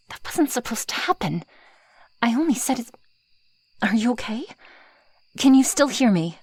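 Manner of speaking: flustered, high-pitched concern; worried panic. Acted. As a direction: anxiously try to rouse someone who fainted. Checking on the fainted human.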